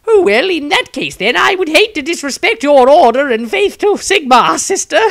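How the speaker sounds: nervous wizard fending off an admirer; flustered wizard. Acted. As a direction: squirm out of it politely. Maestro politely deflects.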